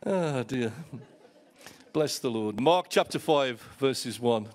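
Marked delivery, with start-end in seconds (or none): none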